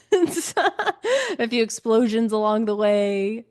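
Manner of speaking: slow, laughing through the words; amused, slow laughing quip. Real, not acted. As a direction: chuckle while joking, slowly. Joking about the messy plot.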